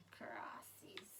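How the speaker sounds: whispering. Real, not acted. Whispered aside.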